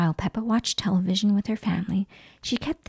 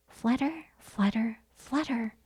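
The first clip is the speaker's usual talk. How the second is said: soft fluttering; 7 dB quieter, slower than usual. A narrator's reading. Fairy flying away.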